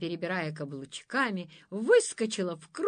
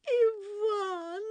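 much higher, tearful sobbed warning; sobbing; 13 semitones higher than usual. Acted. False lady sobbing to the monster, high tearful voice. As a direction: sob out the warning. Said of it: "снова всхлипнула злючка".

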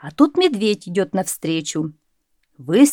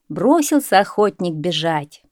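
usual speaking voice

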